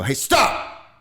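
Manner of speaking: sudden high, loud shout to startle; loud dad voice. Acted. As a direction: bark it out like a dad getting a kid's attention. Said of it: "like, really loud, like, do, like, the dad voice".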